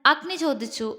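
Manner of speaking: ordinary delivery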